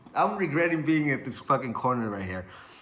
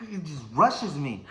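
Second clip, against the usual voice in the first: plain reading voice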